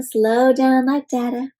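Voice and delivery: plain reading voice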